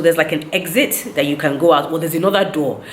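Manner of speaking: ordinary talk, nothing marked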